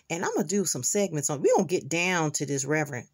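normal talking voice

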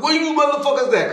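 high, loud mock holler; retold call out. Ronald hollering to find the crew, mock yell.

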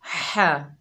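हा breathed from the middle of the throat; throaty ha. Shown as a demonstration. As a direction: push हा from the throat. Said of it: "हा को हलक के दरमियान से अदा करेंगे".

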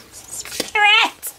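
high squeal through laughter; laughing squeal. Real, not acted. Reader protesting to Spirit, high laughing squeal. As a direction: squeal it out, cracking up.

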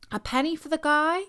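put-on high, sing-song child asking for pennies; lightly voiced kids chanting at doors; child's begging call. A narrator's reading. Children asking neighbours for a penny, high and sing-song.

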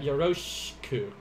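"yoroshiku" said as yoroshku; blended shi. Shown as a demonstration. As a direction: drop the i in "yoroshiku". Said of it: "like that".

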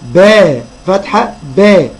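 slow spelling drill, باء فتحة then a long "baa"; teacher demonstrating "baa" slowly after فتحة; letter with fatha. Shown as a demonstration. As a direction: sound out باء with an open a, drawn out. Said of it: "الباء هتتنطق ازاي في كل كلمة".

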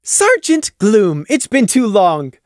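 high, loud, slow greeting; enthused. Acted. Taffy greets Gloom at her door, high and gushing. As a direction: gush over the old friend. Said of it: "Taffy enthused".

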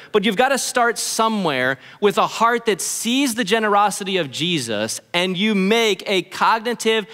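ordinary talk, nothing marked

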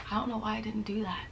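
quick embarrassed whisper; hushed self-scolding; whispered aside. Real, not acted. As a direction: mutter it under your breath in disbelief.

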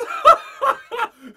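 high, loud burst of laughter; gleeful cackle; delighted laugh. Acted. Commandant delighted by the plan.

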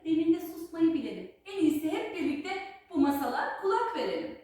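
usual speaking voice

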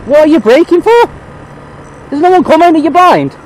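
loud, high-pitched yelling; exasperated shout. Real, not acted. Rider yelling at a braking driver, exasperated shout. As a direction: yell at the driver in disbelief.

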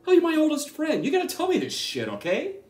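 high, loud exasperated outburst; upset scolding. Acted. Oldest friend hid being sick, upset scolding.

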